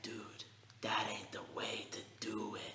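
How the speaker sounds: hushed whisper to himself; breathy whisper of disbelief; whispered aside. Retold or imitated. Kid whispering his shock in class, hushed. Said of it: "even I was like".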